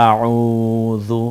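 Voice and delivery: আউযু with a soft z-like ذ, slow and drawn out; আউযু recited slowly in Quranic style; soft zal. Shown as a demonstration. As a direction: recite আউযু, tongue tip lightly on the teeth. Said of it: "মিলিয়ে নরম করে বলতে হয়".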